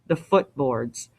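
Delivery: ordinary delivery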